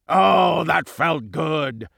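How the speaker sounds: slow, relishing bully voice; gloating villain. A narrator's reading. Villain gloats after head-butt.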